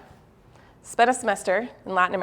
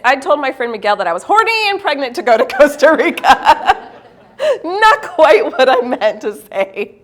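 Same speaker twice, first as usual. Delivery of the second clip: laughing; 3 semitones higher, 4 dB louder than usual. Real, not acted. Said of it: "comic blunder summary".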